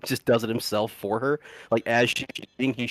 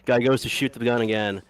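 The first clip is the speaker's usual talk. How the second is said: usual speaking voice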